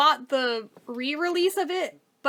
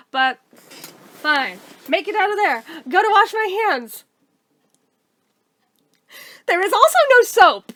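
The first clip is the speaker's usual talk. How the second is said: ordinary talk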